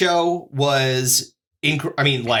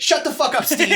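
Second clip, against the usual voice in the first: high-pitched comic put-down; mock scolding; 10 semitones higher than usual. Comic on mic silencing front-row Steve, mock scolding. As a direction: shut him down in a raised, mock-angry voice. Comic puts down man in crowd.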